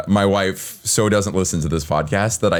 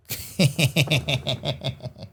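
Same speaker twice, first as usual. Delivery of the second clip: laugh; 9 semitones higher, 5 dB quieter than usual. Real, not acted. Laughing at own story.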